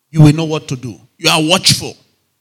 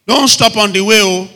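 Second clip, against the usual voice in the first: raised, louder warning call; recalled warning; 5 semitones higher, 6 dB louder than usual. Acted. Traveller remembers the warning on the way, recalled warning. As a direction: echo the warning insistently, pitched up.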